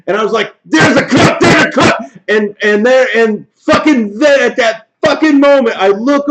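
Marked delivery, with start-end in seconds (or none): 0.7-2.0 high, loud frantic call-out; urgent gamer yell; retold yelling. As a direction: re-enact shouting in the heat of play. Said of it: "I'm fucking yelling".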